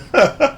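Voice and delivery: high-pitched amused laugh; real tickled laugh. Real, not acted. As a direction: burst out laughing at your own story. Laughs at own retold awkward moment.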